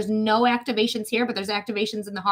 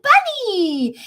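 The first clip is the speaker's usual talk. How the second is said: excited squeal; 9 semitones higher, 4 dB louder than usual. Retold or imitated. Spotting a bunny, happy.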